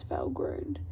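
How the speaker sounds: ordinary delivery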